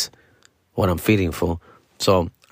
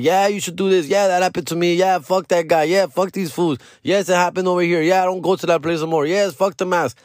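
lightly voiced excited fans, higher pitch; higher-pitched mock chorus of agreement; imitated fan messages; 8 semitones higher than usual. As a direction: rattle off the fans' messages in a raised voice. Voicing fans' supportive messages.

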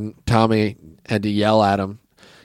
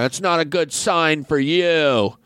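mocking scold; 7 semitones higher than usual. [name] scolding John.

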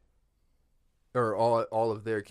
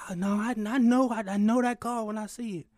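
higher insistent voice; a higher, louder stranger insisting; insistent racer; 12 semitones higher, 4 dB louder than usual. Retold or imitated. Stranger insists he knows granddad's car, higher voice.

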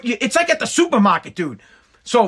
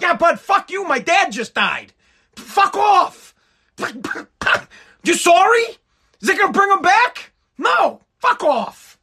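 higher, mock-furious snapping at a mourner; angry outburst; 7 semitones higher than usual. Grieving son snapping at a mourner, mock fury. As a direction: lash out at the pity, half-crying.

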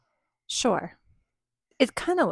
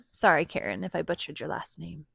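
usual speaking voice

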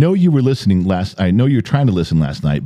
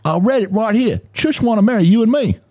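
high-pitched mock Australian accent; thick Australian accent; 14 semitones higher than usual. Friend insisting on what the text says, high Australian accent. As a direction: imitate an Australian running words together. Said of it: "his Australian accent is so thick".

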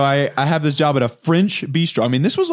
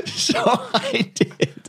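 high, drawn-out words through laughter; laughing confession; 9 semitones higher, 3 dB quieter, much slower than usual. Real, not acted. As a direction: confess it while cracking up. Laughs while confessing the no-show.

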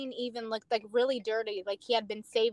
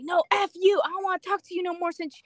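higher, louder, imitating a furious man; mock angry outburst; 6 semitones higher, 4 dB louder than usual. Arrested man lashing out at the officer, mock fury. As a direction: fling it out like a tantrum, retold. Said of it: "He's like".

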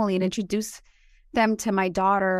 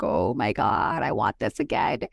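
much lower mock voice craving the food; 12 semitones lower than usual. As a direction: drop the voice way down like a greedy food lover. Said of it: "I was like".

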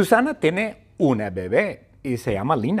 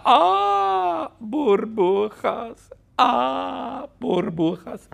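screaming; 11 semitones higher, much slower than usual. Acted. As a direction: shriek at the bubbles in a daze. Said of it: "Susana grita".

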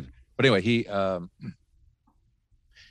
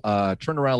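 usual speaking voice

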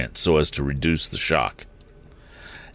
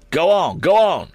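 high, louder urging cry; 12 semitones higher, 5 dB louder than usual. A narrator's reading. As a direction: urge them onward, high and pressing. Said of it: "urged Morse".

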